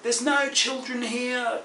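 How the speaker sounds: eerie ghost warning. Retold or imitated.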